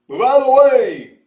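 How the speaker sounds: high, loud commanding yell; shouting. A narrator's reading. Mose orders the crowd aside, loud commanding yell. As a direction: bark it at the crowd.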